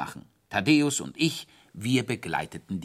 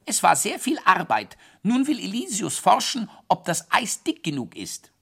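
put-on high, loud sulky kid; squeaky child voice; 11 semitones higher, 6 dB louder than usual. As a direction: squeak it grumpily. Quietsch speaks.

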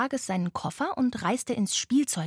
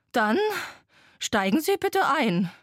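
raised, huffing engine voice; puffing old train; 6 semitones higher, 4 dB louder than usual. A narrator's reading. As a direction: announce boarding like a wheezy locomotive. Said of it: "schnaufte eine verrostete Blecheisenbahn". (schnaufende alte Eisenbahn)

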